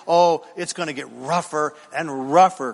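ordinary talk, nothing marked

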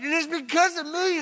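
hammed-up bragging; mocking a proud boast.

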